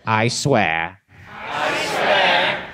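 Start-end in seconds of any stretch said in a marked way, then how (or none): 1.3-2.7 high hushed cultist echo; evil pledge echo. Shown as a demonstration. Crowd repeating the evil pledge, much higher and hushed. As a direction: echo it back like an evil cult choir. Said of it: "recite the Pledge of Evil Allegiance".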